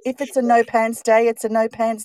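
high, loud, emphatic announcement; acted carefree declaration. Guest acts out a no pants day decision, high and emphatic. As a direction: declare it as a proud personal policy.

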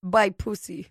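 put-on slow German English accent; drawn out German accent. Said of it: "how do you do a German accent".

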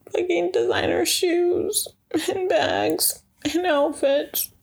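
high whiny mock cry; mock whiny wail. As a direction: whine it like a pretend sob. Longing for designer goods.

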